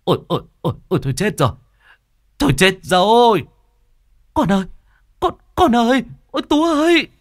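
high, loud, frightened wail; panicked cry. Acted. Healer crying out as the son heads for the stairs, panicked and loud. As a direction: cry out in alarm. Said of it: "Cô An kinh hãi".

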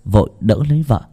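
ordinary delivery

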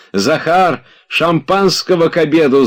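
high, loud call for champagne; shouting. A narrator's reading. Oblomov shouts to his servant Zakhar, gleeful and loud. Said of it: "закричал он".

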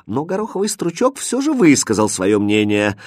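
ordinary delivery

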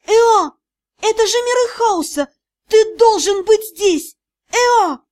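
high, insistent calling; desperate shouting. A narrator's reading. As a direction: cry out, demanding an answer. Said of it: "закричала вдруг я". (отчаянный зовущий крик)

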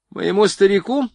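high-pitched narrated woman's cry; calling out. A narrator's reading. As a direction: call it out high and lively. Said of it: "крикнула она". (задорный высокий выкрик)

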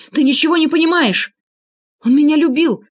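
high, loud, distraught protest; anguished shout. A narrator's reading. Анна defending Влад to his killer, anguished shout. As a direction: cry out in defence of the dead man. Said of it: "страшным голосом крикнула Анна".

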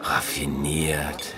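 breathy low whisper, slow and sly. Acted. Producer whispers his approval of the twisted plot. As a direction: breathe the word out, savouring the cleverness. Listener reacts to the plot pitch.

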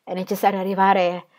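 un piagnucolio che monta in un grido soffocato, più forte verso la fine; piagnucolio inorridito. A narrator's reading. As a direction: come una signora scandalizzata che geme. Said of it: "piagnucolò Fanny inorridita". (piagnucolio inorridito, più forte alla fine)

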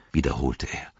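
ordinary delivery, nothing marked

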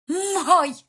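loud, high, desperate claim; desperate cry. A narrator's reading. Mother hitting him, claiming her son, desperate cry. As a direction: cry it out in despair. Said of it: "прохрипела в отчаянии".

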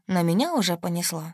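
ordinary delivery, nothing marked

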